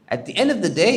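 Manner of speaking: ordinary talk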